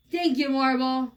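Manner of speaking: usual speaking voice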